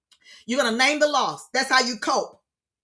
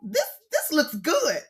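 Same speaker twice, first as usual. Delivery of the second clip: mock delight; 11 semitones higher than usual. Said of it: "I'm like".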